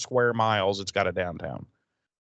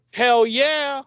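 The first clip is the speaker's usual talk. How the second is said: loud high hell yeah; 14 semitones higher, 7 dB louder than usual. Retold or imitated. [name] excited about Ramones.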